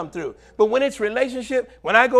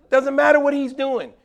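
ordinary talk

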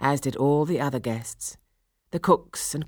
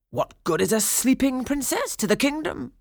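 high-pitched, doubting vizier voice; querulous vizier; 11 semitones higher than usual. A narrator's reading. Vizier Catalabot challenges the fairy, querulous. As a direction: question it in a raised, fussy voice.

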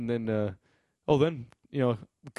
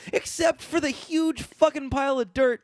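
incredulous rant; 9 semitones higher than usual. Real, not acted.

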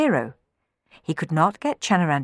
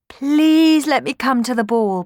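high, loud, slow plea; begging; 8 semitones higher, 6 dB louder than usual. A narrator's reading. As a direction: beg with a drawn-out please. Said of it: "begged Cenerentola".

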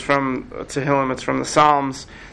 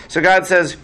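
ordinary talk

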